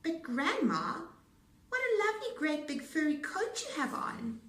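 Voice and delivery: put-on high, loud little girl; a wide-eyed girl admiring the coat; girl's voice. A narrator's reading.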